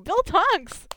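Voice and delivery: high, loud delighted cry; thrilled shout of a name; excited squeal. Real, not acted. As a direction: squeal at the picture. Excited reaction to fan art.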